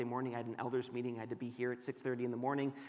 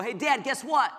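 higher, louder eager burst, imitating a child; excited young son; 9 semitones higher, 7 dB louder than usual. Son waking up to tell his dad about the game, excited. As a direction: imitate a kid bursting with news.

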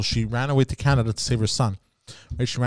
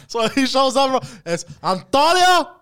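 laughing; 10 semitones higher than usual. Pretend. Announcing the character's entrance.

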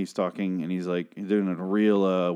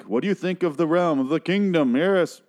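mock old British king, pitched high and loud; hammed-up regal proclamation in a British king's voice; Ezekiel; 7 semitones higher, 4 dB louder than usual. Ezekiel asking Carol about his kingdom, mock British king voice. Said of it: "speaking like an old British king".

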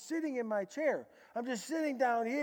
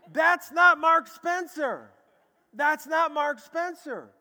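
loud, slow and higher, hammering each word; emphatic declaration; 7 semitones higher, 12 dB louder, slower than usual. Real, not acted. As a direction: proclaim it, not me. Preacher insists, repeated loudly.

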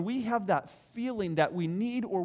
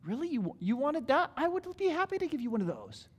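higher, quick, delighted offer; delighted God; 7 semitones higher than usual. Retold or imitated. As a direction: sound thrilled to give.

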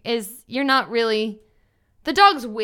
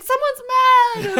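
voicing scared dogs; 12 semitones higher, 4 dB louder than usual. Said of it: "all the other dogs are like".

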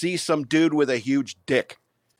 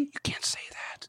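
higher whispered warning, no voice; scolding whisper; 11 semitones higher, 11 dB quieter than usual. Pretend. Telling a friend off about a forbidden word, whispered. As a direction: hiss it as a secret rebuke.

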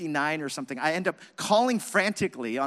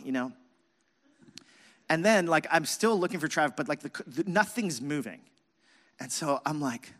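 usual speaking voice

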